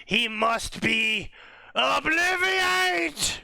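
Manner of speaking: high, slow drawn-out spell; scheming wizard. Retold or imitated. Wizard hiding the truth from Cyrus, slow and high. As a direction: stretch it slow, then cast high. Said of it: "the wizard is like".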